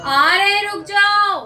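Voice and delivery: high, loud call to wait. Real, not acted. Calling out to waiting child.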